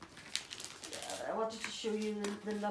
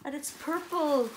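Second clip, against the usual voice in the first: delighted gasp; 6 semitones higher, 3 dB louder than usual. Real, not acted. Discovering the purple dress.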